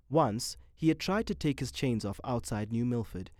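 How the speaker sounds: ordinary delivery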